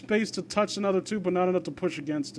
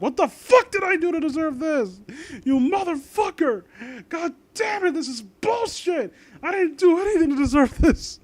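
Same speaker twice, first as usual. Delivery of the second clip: much higher, mock anguished yelling; retold scream at God; 11 semitones higher than usual. Telling viewers about the tooth pain, replaying his high angry yell. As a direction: replay the fury of the toothache night. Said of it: "literally screaming up at God".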